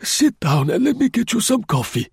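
put-on high old voice, coaxing; calm him down like a fussy grandfather; old concierge offering coffee; placating old man. Grosjean soothing Tournefort.

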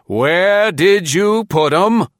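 high, slow, menacing demand. A narrator's reading. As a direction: press for an answer with menace. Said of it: "say menacingly".

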